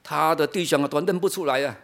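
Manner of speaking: ordinary delivery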